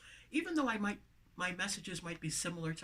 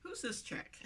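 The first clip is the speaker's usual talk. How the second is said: much higher, soft curious voice; surprised newcomer; 11 semitones higher, 7 dB quieter than usual. Retold or imitated. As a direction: pipe up high and soft, intrigued. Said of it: "until someone says".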